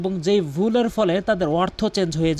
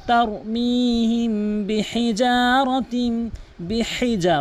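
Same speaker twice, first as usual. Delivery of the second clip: slow, melodic tajweed recitation; Quran recitation; 5 semitones higher, much slower than usual. Acted. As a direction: chant it slowly, holding the long vowels. (সুরেলা ধীর কোরআন তিলাওয়াত)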